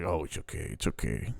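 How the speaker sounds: soft, low imitation of comforting; soothing. Coworker hugging a man who is freaking out, soothing.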